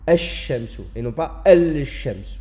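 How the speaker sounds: ash-shamsu sans lam, puis al-shamsu avec le lam, lentement; contraste ash-shamsu. Shown as a demonstration. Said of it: "on dira". (comparaison lente)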